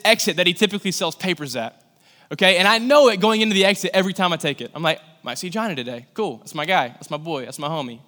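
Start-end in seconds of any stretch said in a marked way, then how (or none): 5.2-8.0 low, soft musing; understated private muttering about a buddy; low self-talk. Retold or imitated. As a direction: think aloud to yourself, casually. Retold thoughts approaching his friend.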